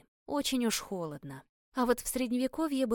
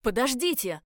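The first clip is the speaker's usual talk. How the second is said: higher, louder cry to wait; urgent shout; 4 semitones higher, 6 dB louder than usual. A narrator's reading. Captain calls after the vanishing heroine, urgent shout. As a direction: call after someone slipping away. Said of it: "крикнул Эль".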